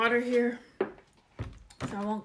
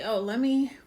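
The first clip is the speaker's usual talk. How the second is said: ordinary talk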